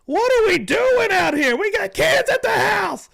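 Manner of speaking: shrieking high, slow scolding mock girlfriend; imitating angry girlfriend. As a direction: screech at your man to calm down, drawn out. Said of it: "She's like".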